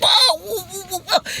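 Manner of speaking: high mock whoops of a thrilled rider. Imitating a rider whooping on the ride, high and excited. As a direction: whoop like you're flying down the track. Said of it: "It's like".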